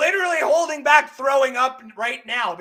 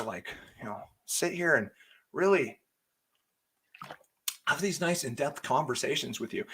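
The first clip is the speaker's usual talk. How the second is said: soft, lower, prissy mock viewer; 7 semitones lower, 7 dB quieter than usual. Mocking a viewer who wanted a calm show, softly. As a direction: parody a polite fan, hushed. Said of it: "It was like".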